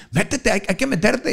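high voice laughing through the urging; laughing mock pal; laughing friend. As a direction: cackle while pushing him on.

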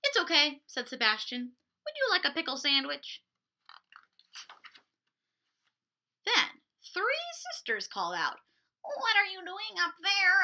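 put-on lively picture-book narration for little kids; storytime reading. A narrator's reading. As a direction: read it like a children's librarian. Picture-book read aloud to kids.

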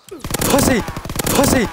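high, loud angry yell at the screen; angry shout. Real, not acted. Gamer yelling at an enemy player, loud angry shout. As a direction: bark the insult at the enemy.

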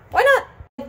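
"Why not" squeaked up very high; high-pitched repeat. Real, not acted. Vlogger talking herself into cutting, high-pitched squeak.

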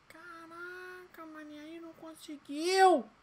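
high slow whining lament; whiny wail. Real, not acted. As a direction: wail it in defeat. Failed challenge.

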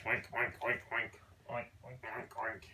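low-pitched piggy oinks. Pretend. Pig sound for a child.

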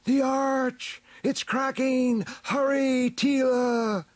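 very high, urgent alarm cry; high, stretched-out shout of danger; shouted warning. A narrator's reading. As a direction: shout a warning from overhead, pitched right up. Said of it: "Stratos shouted".

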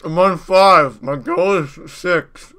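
loud, slow and muffled; cheeks stuffed full. Real, not acted. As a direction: shout through a full mouth. Five marshmallows in mouth.

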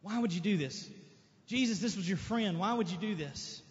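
a hint of mourning reproach; grieving sisters. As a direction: ask it like a heartbroken mourner. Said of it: "All they could do was grieve".

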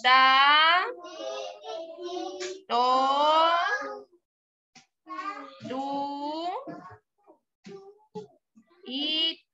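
slow, separate syllables with pauses; ta, to, tu, te said one by one for the class; sounding out syllables. Shown as a demonstration. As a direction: model each syllable for children to repeat. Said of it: "diciendo cómo se llama la sílaba".

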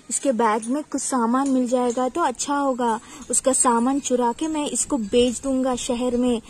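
put-on loud, gloating villain of a children's story; loud, boastful villain plotting aloud; scheming bandit. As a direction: play a robber crowing over his catch.

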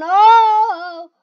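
loud, long, high "oh"; startled yell. Real, not acted. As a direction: cry out in sudden surprise. Startled by the game.

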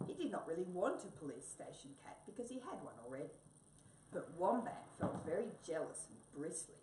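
ordinary delivery, nothing marked

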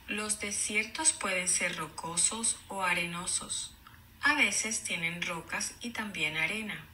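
clear, even read-aloud; storybook reading voice; reading to children. Acted. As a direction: read the page to the class. Teacher reads book aloud.

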